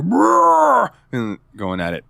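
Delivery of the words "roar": much higher, louder mock roar; hammed-up creature roar; monster roar. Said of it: "the guy's like".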